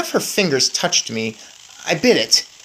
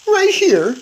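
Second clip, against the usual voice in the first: much higher and louder, a tiny mouse voice; Bruno the mouse; 6 dB louder than usual. A narrator's reading. Bruno answering his friend, high little mouse voice. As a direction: pipe it up like a small mouse.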